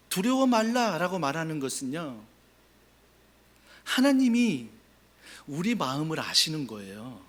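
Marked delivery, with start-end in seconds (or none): none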